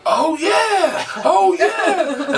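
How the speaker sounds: imitating a room of hyped-up guys; hammed-up crowd excitement; excited cheer. Dudes excited greeting, acted.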